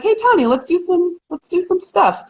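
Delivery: high-pitched, sing-out call to a friend; eager friendly invitation. Retold or imitated. Speaker rallying her friend to plan something together, eager invitation.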